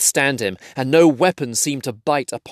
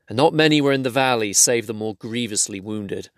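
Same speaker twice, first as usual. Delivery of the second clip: ordinary delivery, nothing marked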